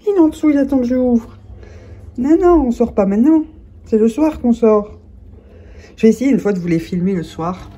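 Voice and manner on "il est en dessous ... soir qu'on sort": high, loud, sing-song scolding; pet talk. Real, not acted. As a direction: chide the little pet brightly.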